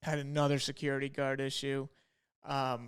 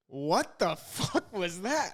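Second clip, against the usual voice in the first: slow and laughing, a bit higher; laughing disbelief; 4 semitones higher, much slower than usual. Retold or imitated. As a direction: crack up while asking it. Retelling a guard's weird remark.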